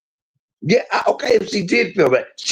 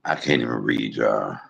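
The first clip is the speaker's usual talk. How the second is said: much lower, soft and worn out; barely getting the words out; drained after the sigh, to viewers; exhausted; 6 dB quieter than usual. Real, not acted.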